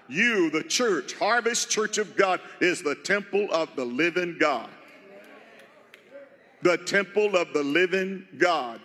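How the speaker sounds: usual speaking voice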